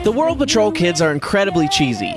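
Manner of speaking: ordinary delivery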